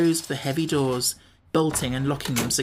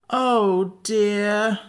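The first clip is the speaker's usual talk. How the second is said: moaning; 6 semitones higher than usual. A narrator's reading. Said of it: "moaned Jack".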